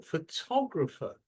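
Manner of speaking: Photographer with TOG as the strong part; Photographer, weak first syllable, stressed second. Shown as a demonstration. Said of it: "Second syllables".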